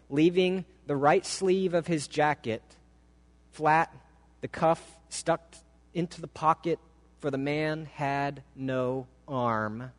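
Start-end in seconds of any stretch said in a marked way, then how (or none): none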